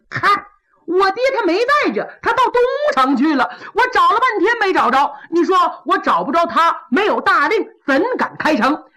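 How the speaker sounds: butter up the general, then refuse; fawning Pan Hu. Acted. Pan Hu makes excuses. (潘虎假意奉承，高声油滑)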